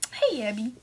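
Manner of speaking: ordinary talk, nothing marked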